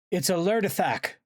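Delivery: high, urgent cry of warning; yelled alarm. A narrator's reading. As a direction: shout the discovery in alarm. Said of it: "Axe yelled".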